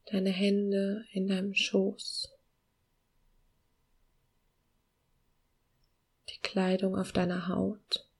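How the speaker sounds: slow, soft meditation voice with long pauses; calm guiding voice, gently slowing down; guided meditation. Acted.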